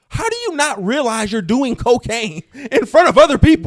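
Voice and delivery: high-pitched, exasperated disbelief; incredulous outburst. Real, not acted. Podcast host reacting to a message excuse, incredulous. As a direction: call out absurd behavior, voice up high.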